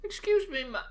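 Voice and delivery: high indignant yelp; squeaking with outrage; outraged squeak. Real, not acted. Indignant outburst at quest giver.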